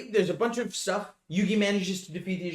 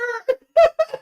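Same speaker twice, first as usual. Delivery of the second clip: high burst of laughter; 11 dB louder than usual. Real, not acted. Laughing at Joey's line.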